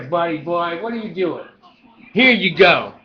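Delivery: normal talking voice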